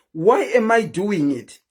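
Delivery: normal talking voice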